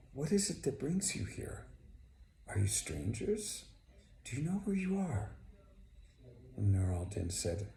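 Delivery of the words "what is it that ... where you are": curious, lifted questions, much higher in places; lightly acted host, pitch swinging up on questions; lightly voiced welcoming host. Old man to sleeping strangers at his garden, gently inquisitive.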